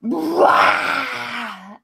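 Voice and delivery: put-on loud growling roar to scare kids off; bear growl. Giant roaring at clinging kids, loud bear growl. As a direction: roar like a bear to shake them off. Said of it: "does this bear, like, growl".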